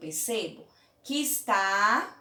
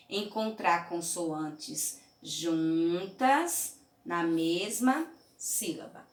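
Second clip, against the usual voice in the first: slow emphasis; 2 semitones lower, slower than usual. Shown as a demonstration. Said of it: "este é o nosso desafio".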